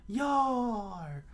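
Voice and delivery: loud, very high squeal; high mock war cry; pirates' battle cry. A narrator's reading. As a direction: yell it as a falsetto pirate charge. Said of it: "screamed the pirates".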